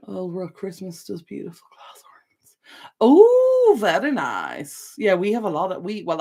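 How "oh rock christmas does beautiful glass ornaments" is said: whispering. Real, not acted. Reading chat message aloud.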